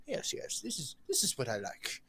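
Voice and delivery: softly voiced, furtively pleased noble; smiling noble. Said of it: "nobles just looking away, just kind of smiling".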